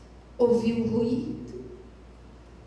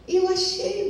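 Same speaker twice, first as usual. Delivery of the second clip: high, louder cry of mine; insistent claim; 11 semitones higher, 5 dB louder than usual. Acted. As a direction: blurt it out defiantly. Son claims the baby. (afirmação insistente, alta e aguda)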